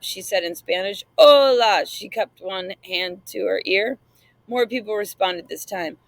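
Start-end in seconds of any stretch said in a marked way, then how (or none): 1.2-1.8 high, loud call to rouse the class; teacher calling out to a sleepy class, loud; loud wake-up call. A narrator's reading. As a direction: shout it to wake the room. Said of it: "We need to wake you people up".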